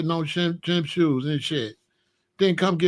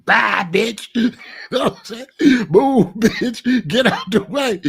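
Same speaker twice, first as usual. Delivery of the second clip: laughing dismissal; 4 semitones higher, 5 dB louder than usual. Real, not acted.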